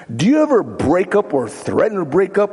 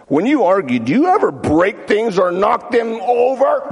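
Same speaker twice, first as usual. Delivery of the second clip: raised, emphatic question; 6 semitones higher than usual. Acted. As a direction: punch the question out. Question then repeated quietly.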